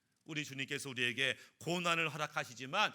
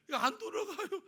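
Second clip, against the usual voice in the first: squeaky laughing complaint, much higher; giggling through the customer's line; 13 semitones higher than usual. Acted. Customer shows stiff shoulder.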